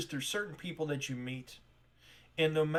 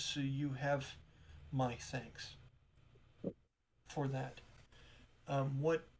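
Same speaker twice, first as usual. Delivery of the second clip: softer, hesitant apologetic shop clerk; flustered shopkeeper; 8 dB quieter than usual. Acted. Tamara apologizing to strangers, softly flustered. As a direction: stumble politely, a little embarrassed.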